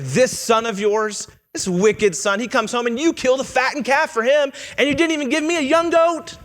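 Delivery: a jealous son building his complaint, pitch rising; resentful whine. Retold or imitated. Preacher mimics older son complaining to father, rising whine. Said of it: "Just a terrible attitude".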